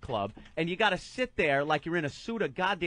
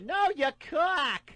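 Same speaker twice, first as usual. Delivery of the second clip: put-on high, slow insistent girl; high woman's voice; 13 semitones higher, 4 dB louder, slower than usual. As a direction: correct him, drawn out and high. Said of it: "Like, I didn't understand".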